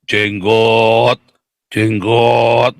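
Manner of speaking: low, drawn-out droning word; cow-like lowing. Pretend. Joking about riding a cow, low lowing voice. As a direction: moo the word in a low monotone. Continues the cow imitation with words.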